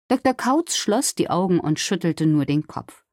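ordinary delivery, nothing marked

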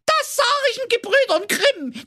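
very high, whining wail; wailing boo hoo crying. Acted. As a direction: howl it like a sore loser. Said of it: "Da heulte der böse Zwerg".

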